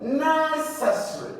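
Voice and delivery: necessary with NE held high; teaching stress on NE-cessary; stress on first syllable. Shown as a demonstration. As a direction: lift and stretch NE in necessary. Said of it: "It's the first syllable".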